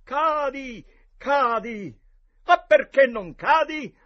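high, loud frustrated shouting; grido. A narrator's reading. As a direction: yell at him to fall. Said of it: "Tom gridò". (Tom grida a Joe di cadere, forte e acuto)

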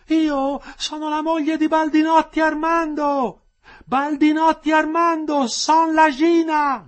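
very high, stretched-out yell; woman shouting out. A narrator's reading. Second wife calling her husband in prison, shouting. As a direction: call the name loudly and slowly. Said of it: "gridò la seconda".

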